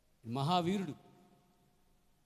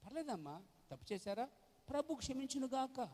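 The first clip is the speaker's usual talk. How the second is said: higher, softer sugary pastor voice; mock lenient pastor; 11 semitones higher, 5 dB quieter than usual. Preacher mocking a lax pastor, sugary and high. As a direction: parody a soft, easygoing pastor.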